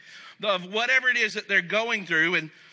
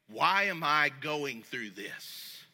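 slow, lower, quieter, a sulky "Why am I going through this?"; entitled complaint; 8 semitones lower, 4 dB quieter, slower than usual. Shown as a demonstration. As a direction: drag it out like you don't deserve this. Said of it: "I'm going".

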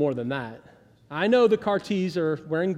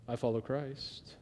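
hushed, soft, lower smug piety; mock pious whisper; 6 semitones lower, 12 dB quieter, slower than usual. Mocking the super spiritual person again, hushed and low. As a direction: breathe it like a self-righteous saint. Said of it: "That super spiritual person?".

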